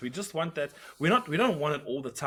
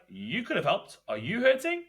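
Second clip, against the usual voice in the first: sarcastic question; 8 semitones higher than usual. Retold or imitated. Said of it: "And I was like".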